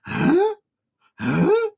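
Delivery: squeaky high mock confused huh; baffled huh. Streamer retelling a misread bracket, squeaky mock confusion. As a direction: replay the double take, way up high. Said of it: "and I was going".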